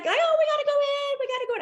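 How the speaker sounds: high, coaxing squeal, imitating her friend; eager girlfriend. Girlfriend begging to enter the shoe boutique, high excited. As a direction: pull her toward the shop, high and eager. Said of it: "my girlfriend's like".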